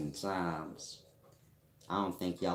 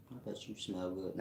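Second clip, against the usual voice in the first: Mac voice; 9 dB quieter, faster than usual. Pretend. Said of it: "that's my Mac voice".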